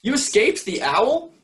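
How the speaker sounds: high, joyful disbelief; excited cry. Acted. Stellaluna finds her lost mother alive, crying out. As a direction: cry it out, overjoyed. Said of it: "cried Stellaluna".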